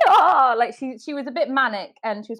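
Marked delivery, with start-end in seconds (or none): 0.1-0.5 raspy rocker voice. Retold or imitated.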